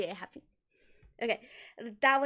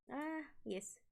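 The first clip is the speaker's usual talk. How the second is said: ordinary talk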